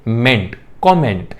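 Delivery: कमेंट said kom-ment, the last syllable with a full e; the teacher gives the exception, comment ending in ment. Shown as a demonstration. कमेंट said kom-ment, with a full e at the end. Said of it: "कमेंट नहीं".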